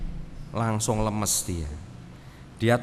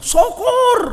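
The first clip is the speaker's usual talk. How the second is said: very high, loud sneering "Syukur"; mocking gloat; 12 dB louder than usual. Imagined spiteful Jesus gloating at empty nets, shrill. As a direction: gloat over their failure. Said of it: "kalau Tuhan Yesus ngomongnya".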